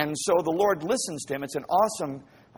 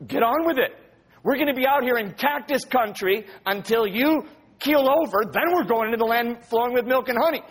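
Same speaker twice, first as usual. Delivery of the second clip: put-on high, exasperated voice of a fed-up child; mock youthful impatience; impatient kid; 7 semitones higher, 3 dB louder than usual. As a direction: complain loudly as a kid sick of waiting. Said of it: "you know, you're like".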